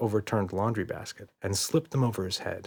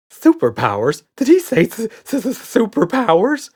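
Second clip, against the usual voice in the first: much higher, loud frightened stammer; stuttering; 13 semitones higher, 9 dB louder than usual. A narrator's reading. Red hears about Daddy's superpowers, stuttering in fear. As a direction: stammer in terror. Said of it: "stuttered Red".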